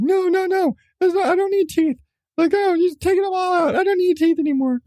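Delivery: put-on high, whining little-boy voice; whiny kid protest. Mom mimicking her son refusing his tooth. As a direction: squeal it like a panicked seven-year-old.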